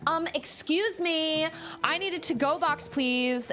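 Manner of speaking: high, slow, entitled demand; Karen voice. Retold or imitated. Mom mocking a rude customer, slow high snotty. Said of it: "being a Karen".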